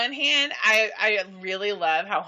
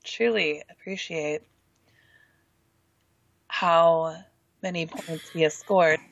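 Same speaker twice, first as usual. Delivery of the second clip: sexy voice; 3 semitones lower than usual. Shown as a demonstration. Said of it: "you have to say it in the sexy voice".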